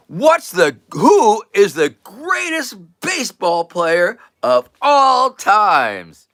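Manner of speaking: high, loud, emphatic quizmaster delivery; showman's big question. Acted. Guy turns to his dog for last chance, showman's big question. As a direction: build it up as the grand final question.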